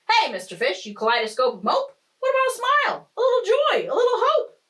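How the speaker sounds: very high, loud squealing character voice; high-pitched, insistent squid; pushy squid. Acted. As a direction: climb high and loud, nagging the grump. Impolite squid scolds gloomy fish.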